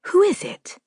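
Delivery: high, startled call through the door; a nervous young scholar calling out; young man asking. A narrator's reading. Scholar calling to a knock at night, high and startled.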